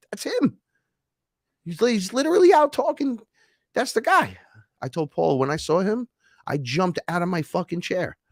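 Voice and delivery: high, straining insistence; agitated recognition. Retold or imitated. Telling his friend that's the guy, agitated. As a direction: insist excitedly, voice climbing very high. Retells recognizing man from ship.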